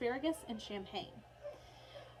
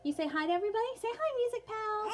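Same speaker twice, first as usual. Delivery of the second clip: high, louder sing-song prompt; 10 semitones higher, 3 dB louder than usual. Real, not acted. Teacher urging her daughter to greet viewers, high and coaxing.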